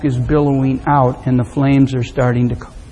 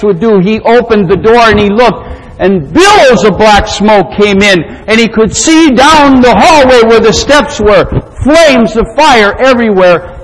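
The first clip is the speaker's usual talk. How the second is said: raised, driving storyteller's voice; dramatic story narration; 7 semitones higher, 7 dB louder than usual. A narrator's reading. Preacher telling of little Charles in the fire, urgent narration. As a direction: build the danger loudly, pitched up.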